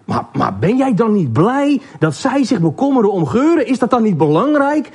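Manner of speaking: high, astonished questioning; stumbling into an incredulous question; bewildered. Acted. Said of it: "Vol verbijstering staarde Neus hem aan".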